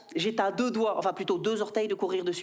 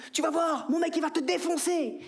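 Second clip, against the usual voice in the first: high, taunting woman's threat; much higher mocking taunt; 6 semitones higher than usual. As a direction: jeer it like a cocky girlfriend. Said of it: "C'est qu'elle me dit".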